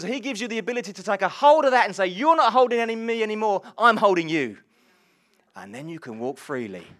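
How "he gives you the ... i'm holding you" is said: loud, high, driving preacher's call; rally the congregation with rising fervour; fervent sermon climax, slowing on key lines; preaching at full force. Acted. Preaching climax on being freed.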